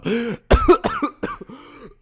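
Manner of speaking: hard coughing fit; coughing on dust. Real, not acted. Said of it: "I breathed in dust".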